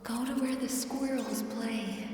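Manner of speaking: slow, hushed hypnotic guiding voice; guided dream session. Acted. Therapist guiding a sleeping patient through a dream session, slow and hushed. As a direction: lull the patient gently, word by word.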